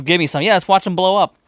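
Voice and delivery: put-on a kid thrilled to blow up dolls; excited kid. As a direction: jump in gleefully wanting some. [name] eagerly joining in.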